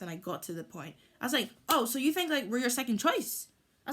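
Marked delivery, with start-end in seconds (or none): 1.7-3.5 sharp, higher and louder retort; snapping at him. Retold or imitated. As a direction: snap back at him, offended. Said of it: "that's when I kinda, like, snapped".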